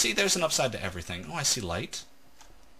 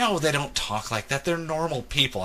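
higher-pitched retort in a wife's voice; higher imitation of an exasperated wife; imitated wife; 6 semitones higher than usual. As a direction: mimic a wife dismissing a silly idea. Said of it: "And she says".

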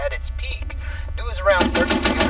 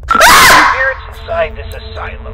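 high, slow, shouted incantation; booming summons; 13 semitones higher, 11 dB louder, slower than usual. Acted. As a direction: call out to the spirits.

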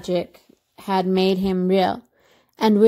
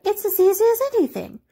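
high-pitched show-off rabbit; high storybook animal voice; boastful rabbit; 11 semitones higher, faster than usual. A narrator's reading. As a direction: brag in a high character voice. Furry rabbit shows off.

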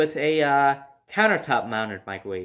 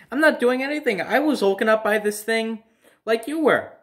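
higher pitched, indignant denial; defensive protest; 7 semitones higher than usual. Retold or imitated. Kid protesting to his mom that he did nothing, indignant. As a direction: insist you're innocent, pitch up.

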